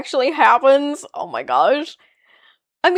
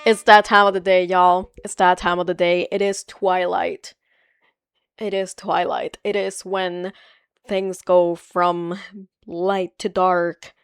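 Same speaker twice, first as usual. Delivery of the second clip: low, slowing story narration; game intro narration; 5 semitones lower than usual. Retold or imitated. Streamer quoting the Twilight Princess intro, solemn narration. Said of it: "the rest of the intro to Twilight Princess".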